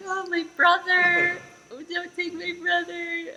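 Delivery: high, slow, tearful pleading; crying plea. Retold or imitated. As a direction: beg through tears not to lose a brother. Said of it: "I'm like".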